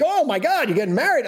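high-pitched mock amazement; astonished reaction. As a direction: imitate colleagues gasping at big news. Colleagues react to wedding news.

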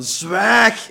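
sharp loud high command; schreeuw. Retold or imitated. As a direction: shout the set into silence. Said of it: "echt schreeuwt naar u van". (luid bevel om te zwijgen)

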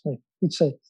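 usual speaking voice

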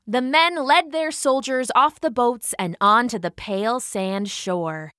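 ordinary delivery, nothing marked

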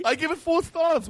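high-pitched quick verdict; pitched well up, falling at the ends; eager answer. Acted. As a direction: answer in a high voice before hearing the question. Guest eagerly reviews unnamed restaurant.

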